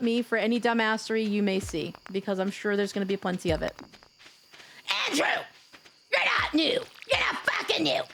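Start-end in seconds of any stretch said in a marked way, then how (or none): 5.1-8.0 yelling. Acted. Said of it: "I have to yell at you".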